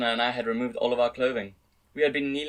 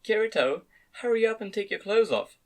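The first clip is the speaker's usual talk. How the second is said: commanding, higher-pitched order; authoritative; 9 semitones higher than usual. A narrator's reading. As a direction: tell him what to do, no arguing. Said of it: "she said in an authoritative tone".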